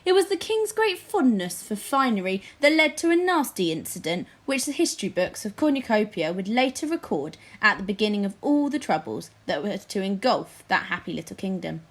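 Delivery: ordinary delivery, nothing marked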